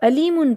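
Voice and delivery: علیمن opened on a simple a; علیمن mispronounced with initial alif; alif instead of ain. Shown as a demonstration. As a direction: say علیمن as alimun, without the throaty ain. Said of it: "الف سے پڑھ دیں گے".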